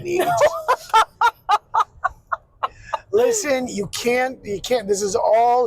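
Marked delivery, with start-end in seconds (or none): 0.3-2.1 big laugh. Real, not acted.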